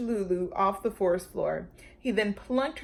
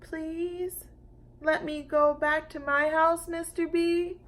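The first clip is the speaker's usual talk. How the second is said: less bossy pleading; 8 semitones higher than usual. A narrator's reading. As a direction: ask nicely, pleading. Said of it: "a lot less bossier voice".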